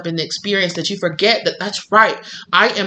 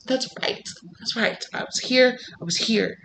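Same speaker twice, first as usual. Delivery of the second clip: quiet realization; 4 semitones higher, 9 dB quieter than usual. Pretend. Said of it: "you're like".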